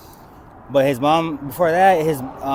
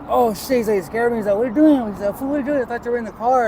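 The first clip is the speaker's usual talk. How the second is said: much higher, startled yelp then scolding; jumped out of his skin; startled brother; 8 semitones higher, 5 dB louder, faster than usual. Retold or imitated. Brother finding him on the porch, startled and high. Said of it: "and he's like".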